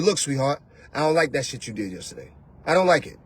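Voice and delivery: a real man stating it plainly; a hint of a calm man. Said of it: "So he can tell you".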